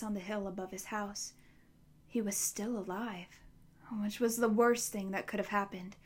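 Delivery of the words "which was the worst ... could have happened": usual speaking voice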